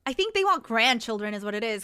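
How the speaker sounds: ordinary delivery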